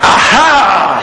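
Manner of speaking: a laughing, triumphant "Aha!"; gleeful gotcha. Retold or imitated. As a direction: catch her out with glee. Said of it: "And I went".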